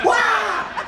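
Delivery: high loud scream of fright; startled yelp. Retold or imitated. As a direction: shriek like a skydiver is landing on you. Startled by mirror on ceiling.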